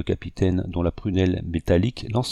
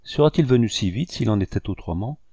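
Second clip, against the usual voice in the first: ordinary delivery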